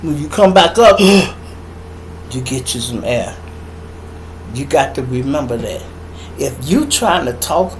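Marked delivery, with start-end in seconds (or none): 1.8-2.2 quick gasp on surfacing; demonstrated sharp intake of breath; gasp for air. Shown as a demonstration. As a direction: suck in air like a swimmer coming up.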